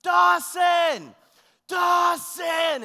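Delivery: put-on full-voice, high, drawn-out call for a lost child; loud, high-pitched desperate shout; yelling. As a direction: yell the name across a crowded park. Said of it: "I just started yelling".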